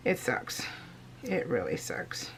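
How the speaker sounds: quiet. Real, not acted. Telling viewers about surgery pain, very quiet. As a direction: let it fade to near nothing.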